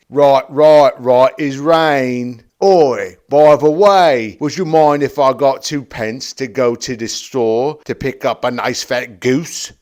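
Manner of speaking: very loud, broad Cockney; hammed-up Cockney, vowels drawn wide; silly Cockney. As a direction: bellow it like a cartoon Londoner. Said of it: "That's how he's sounding".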